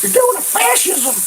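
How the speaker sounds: high, mock-hysterical squeal. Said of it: "people screaming stupid shit like".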